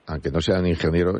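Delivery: usual speaking voice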